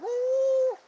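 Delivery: high, loud "woo" howl; playful mock dog howl; imitated dog howl. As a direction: howl like the little dog getting into it. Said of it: "he'd start going".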